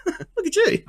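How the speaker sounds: high, laughing jab at a friend; laughing tease. Retold or imitated. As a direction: crack up while mocking him. Joking at coworker's hand.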